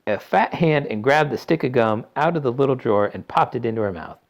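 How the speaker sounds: ordinary delivery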